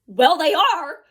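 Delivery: imagined indignant comeback; sassy retort. Retold or imitated. Retort she only thought in her head.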